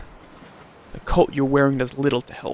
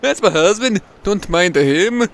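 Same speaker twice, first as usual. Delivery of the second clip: slow, higher mock Swedish lilt; Swedish accent; 5 semitones higher, 6 dB louder, slower than usual. [name] explaining her husband, slow mock Swedish. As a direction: drift into a hammed-up Swedish accent. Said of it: "Why am I Swedish?".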